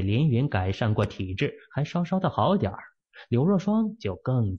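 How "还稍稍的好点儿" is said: ordinary delivery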